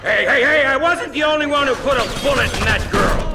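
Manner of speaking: loud defensive shout; angry retort. Acted. Lynch yelling back at the dealer in anger. Argument with partner.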